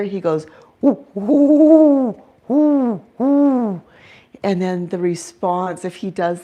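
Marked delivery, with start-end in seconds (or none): 0.8-3.8 high, loud hooting owl call; a loud owl woo, woo; owl greeting hoot. Retold or imitated. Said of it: "the way he would greet".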